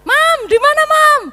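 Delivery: loud, high call down a bad line; calling out on phone. Father phoning his absent wife: loud high call over bad line. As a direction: shout for her over a broken signal.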